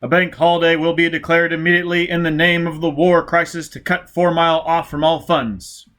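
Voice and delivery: official broadcast voice. Acted. Police radio bulletin announcing the war crisis, loud and clipped. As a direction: bark out the emergency bulletin.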